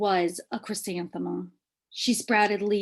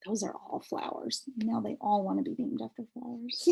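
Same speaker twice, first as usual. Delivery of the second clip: aside; 6 dB quieter than usual. Real, not acted. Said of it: "aside to listener".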